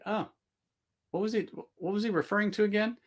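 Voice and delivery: high, fast puzzled question to himself; puzzled self-quote. Retold or imitated. Streamer recalling reading a chat reply, puzzled high. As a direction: replay his own confusion reading the reply. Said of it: "I'm like".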